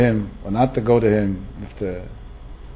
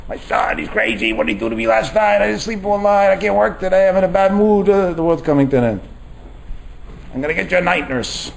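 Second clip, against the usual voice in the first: higher, whiny complaining parent; mock the self-pitying tired parent; lightly voiced parent moaning about a sleepless night; nagging parent; 6 semitones higher than usual.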